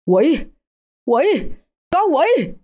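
high panicked scream; terrified scream. Acted. Mận screams at ghost Hoa. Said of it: "thét lên".

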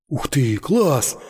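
slow, drawn-out wow; exclaiming. A narrator's reading. Васёк admires the parabellum, slow amazed exclamation. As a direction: marvel at the pistol. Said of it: "воскликнул Васёк".

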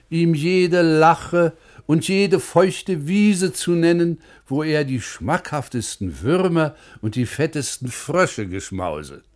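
usual speaking voice

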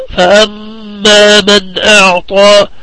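slow chant, humming long on the doubled م of فأما; tajweed ghunnah recitation. Shown as a demonstration. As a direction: hold the مّ of فأما nasally and stretch the long vowels. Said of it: "وجوب الغنة فيهما".

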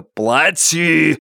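drawn-out menacing demand; heavy, threatening command, pitch lifted. A narrator's reading. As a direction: cut in with a forceful order. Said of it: "угрожающе навис над хозяином".

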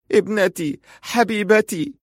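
high-pitched weeping mother, acted; tearful. A narrator's reading. Mother crying over her injured daughter, high tearful voice. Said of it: "وهي تلهث وتبكي".

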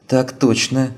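sharp, high, eager reply; exclaiming. A narrator's reading. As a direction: snap to attention and exclaim it. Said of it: "воскликнула Лина".

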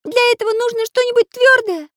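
very high, loud little voice; exasperated character. A narrator's reading. Artist explains his problem to friends, high and exasperated. Pencil keeps answering in character.

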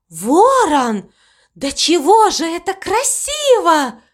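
high-pitched delighted wonder; admiring exclamation. A narrator's reading. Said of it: "воскликнула мама Му".

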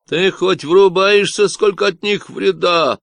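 a lazy Texan voice on the walkie-talkie; Texan drawl. Acted. Stranger on the radio lecturing Michael, nasal Texan accent. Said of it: "прогундосил чей-то голос с техасским акцентом".

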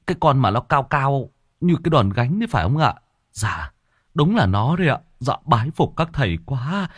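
respectful villager full of admiration; awed, grateful villager. Acted. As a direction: gush humble thanks to the masters.

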